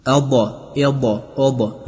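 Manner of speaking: ابا said with a bouncing release and an echo vowel on the ض; wrong bouncing ض. Shown as a demonstration. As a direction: show the mistake of bouncing ابا. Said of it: "نخیر".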